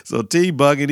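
ordinary talk

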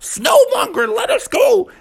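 very high, squealing plea; pleading scream. Acted. Froggy and dad beg the Snowmonger, high-pitched screaming. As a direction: squeal to be released. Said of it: "they both were like".

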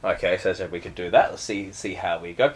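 normal talking voice

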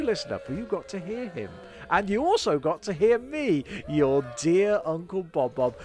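ordinary delivery

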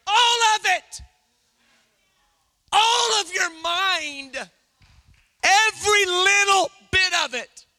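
loud, drawn-out preacher's emphasis; full-force preaching. Real, not acted. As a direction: drive each phrase home to the congregation.

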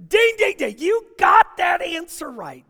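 game show buzzer. Acted. Jesus cheering the young lawyer's right answer, shouted like a game host. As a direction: ring it out like a quiz host crowning a winner. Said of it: "Jesus goes".

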